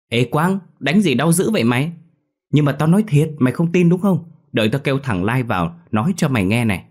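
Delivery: put-on high, loud childish whine; a kicked boy protesting to his friend, high whine; whiny hurt kid. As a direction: complain like a bruised ten-year-old. Lì hurt, voiced higher.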